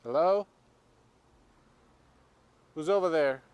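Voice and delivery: loud, high, questioning call. Real, not acted. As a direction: shout to whoever is out there. Calling to a possible stranger.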